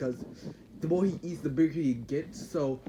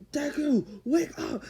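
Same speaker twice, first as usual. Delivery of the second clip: calling out; 11 semitones higher, slower than usual. Retold or imitated. Bakugo yelling to wake Deku.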